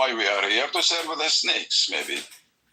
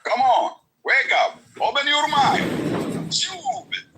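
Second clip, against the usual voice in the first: exasperated shout; 9 semitones higher, slower than usual. Real, not acted.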